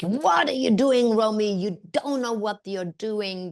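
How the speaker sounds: acted-out dressing-down; insulting tirade. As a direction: lay into her like a contemptuous director. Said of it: "laid into her and insulted her".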